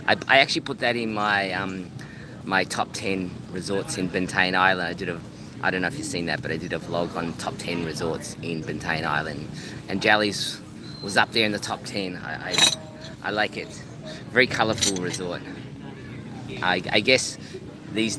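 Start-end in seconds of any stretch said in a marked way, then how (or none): none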